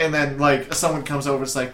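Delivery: usual speaking voice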